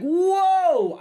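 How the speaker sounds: high startled whoa; dad's mock shock at his son swearing. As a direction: gasp it out in mock surprise. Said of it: "I was like".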